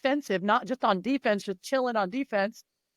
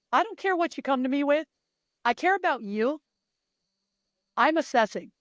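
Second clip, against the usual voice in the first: ordinary delivery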